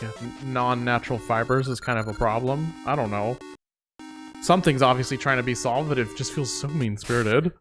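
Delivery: ordinary talk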